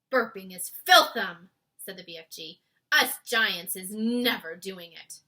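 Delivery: the giant declares it firmly. A narrator's reading. BFG giant voice.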